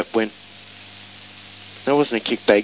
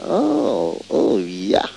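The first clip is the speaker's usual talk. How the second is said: disgusted; much slower than usual. Retold or imitated. Recalling someone eating from the garbage, disgusted. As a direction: recoil in disgust, oh sliding down to yuck.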